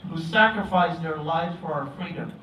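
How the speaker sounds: ordinary delivery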